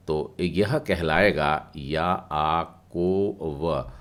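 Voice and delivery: याआकोव with the या drawn out long, then कोव; याआकोव, long ya, slow teaching voice; stretched ya sound. Shown as a demonstration. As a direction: pull out the या in याआकोव slowly. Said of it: "मतलब या को थोड़ा खींच करके या".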